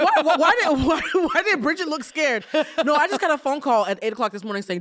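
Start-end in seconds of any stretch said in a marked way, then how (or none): 0.0-2.5 laughing, higher and louder; cracking up while asking; giggly high question. Real, not acted. Laughing at Bridget's face.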